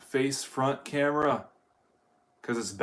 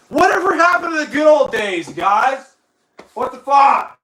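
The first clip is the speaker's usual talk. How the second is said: very high, loud comic rant; mock-outraged yell; 12 semitones higher, 9 dB louder than usual. Streamer lamenting the good old days to viewers, mock-outraged yell. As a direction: holler it in exaggerated outrage.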